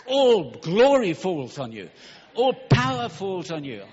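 slow, loud, ringing proclamation; full-force preaching. Real, not acted. Preacher declaring blessing over the congregation, slow and loud. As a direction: drag out each word and declare it loud.

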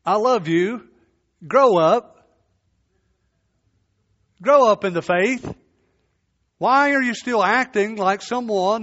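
loud, pleading call to grow up; loving rebuke. Pretend. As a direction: scold a fellow believer out of love. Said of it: "a brother or sister to look at us and say".

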